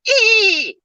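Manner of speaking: a tiny high-pitched fan squeal. Shown as a demonstration. Fanboy squealing at the thought of meeting Jeremy, very high. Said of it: "I'd probably do something like".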